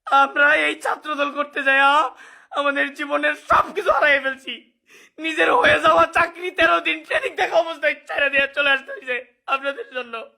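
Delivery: weeping, voice breaking; tearful outburst of grievance; snotty crying. Real, not acted. Crying while complaining.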